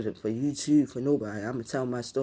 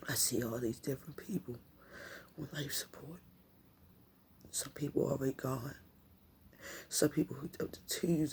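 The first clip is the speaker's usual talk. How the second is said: whispering; 8 dB quieter than usual. Real, not acted. Recalling ICU, says crying.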